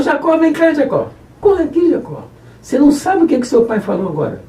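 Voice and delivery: high, urgent mother's call to her son; mother in the story calls Jacó to hurry over, high calling voice. Acted.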